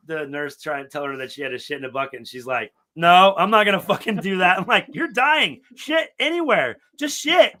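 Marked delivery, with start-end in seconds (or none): 3.0-4.6 loud, high retold protest; imitating a stubborn, high-pitched objection; indignant refusal. Acts patient's refusal. 4.9-7.6 very high, loud urging; retold high-pitched, emphatic plea; exasperated insistence. Acts exasperated reply to patient.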